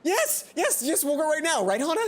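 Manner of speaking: high, loud gushing yes; overjoyed shout. Acted. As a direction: burst out with fan-girl joy. Mai accepts invitation excitedly.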